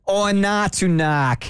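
put-on thick Boston accent, drawn out. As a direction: knock or not, slow Boston accent. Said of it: "I'm really bad at accents".

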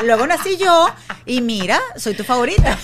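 high, loud, laughing cheeky daughter; teasing daughter, laughing. Retold or imitated. Mother voicing her daughter's boast, laughing loudly and high. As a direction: playfully boast through laughter. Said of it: "así mismo me dice".

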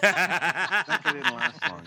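loud, slow laughing line. Real, not acted. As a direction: laugh through the words. Laughing joke about the character.

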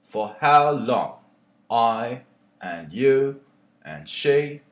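performed poem with mounting ire; poem reading. Acted. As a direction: read it like a rising protest, building indignation. Reads his own poem aloud.

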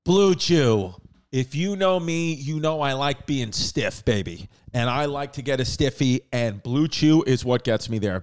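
easygoing sponsor read. Acted. Host reading a Blue Chew ad to listeners, casual ad read.

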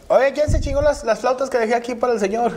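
high-pitched mock indignant señora; complaining mother-in-law. Mother-in-law asks who ate the food, mock indignant. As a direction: demand an answer, imitating her in a high voice.

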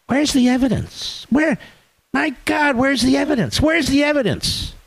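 high whiny mock questioning. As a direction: whine it like a skeptic. Mocking media critics.

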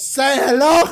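mock Scarface shout; Scarface line. Friend bursts from the bathroom, hammed-up gangster. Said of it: "he goes".

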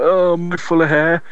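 lightly voiced let-down infant; imitating a baby. Dad voicing his baby at his chest, mock disappointment. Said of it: "he's like".

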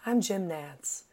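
soft, hushed golf-broadcast welcome; lightly imitating a quiet TV announcer; hushed Jim Nantz. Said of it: "but he always goes".

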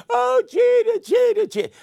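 put-on very high, loud girlish swooning; swooning fan girls. Mimicking girls swooning over Jeter, high squeal. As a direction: squeal his name like a smitten fan. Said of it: "the girls would wear number two".

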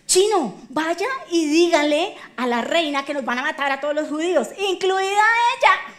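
loud dispatch to a messenger, building; hammed-up urgent message, last words stretched; acted urgent order. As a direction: call out the alarm, rising to a high peak.

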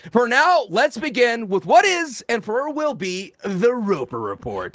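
booming hype announcer intro. Acted. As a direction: announce it like a host. Announcing the show segment.

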